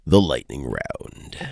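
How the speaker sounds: slow, deep show announcer. Shown as a demonstration. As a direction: announce it like a game show host. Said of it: "the part of the show that I call".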